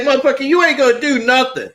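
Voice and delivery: high, fast taunt through laughter; hammed-up taunt, half laughing; mock taunting. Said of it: "like, taunting him".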